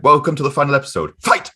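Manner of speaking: loud, high, fast mock announcement; hammed-up game announcer call; mock fight announcer. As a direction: announce a showdown, building to a shout. Mimics finale as a fight announcement.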